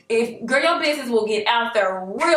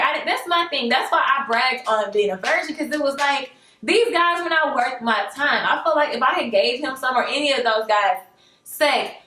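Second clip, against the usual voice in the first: ordinary talk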